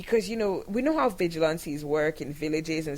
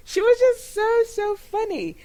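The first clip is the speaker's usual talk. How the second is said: laughing; 14 semitones higher, 7 dB louder than usual. Real, not acted. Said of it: "that's why I'm laughing".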